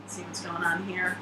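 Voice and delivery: ordinary delivery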